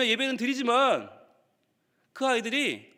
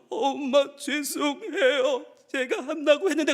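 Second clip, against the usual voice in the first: hammed-up high, weepy apology; mock-sob slowly, dragging the words out; tearful child; 4 semitones higher, slower than usual. Child apologizing to mom over grades, tearful. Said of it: "뚝뚝 눈물 흘리면서".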